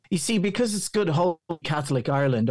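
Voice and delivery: ordinary talk, nothing marked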